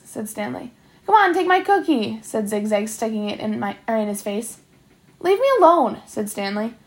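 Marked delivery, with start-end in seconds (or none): 1.0-2.2 higher-pitched, pushy coaxing; needling insistence; insistent pestering. A narrator's reading. Bully pestering with repeated cookie offer. 5.2-6.0 raised, higher-pitched protest; exasperated and louder; annoyed protest. A narrator's reading. Pestered boy tells bully to stop.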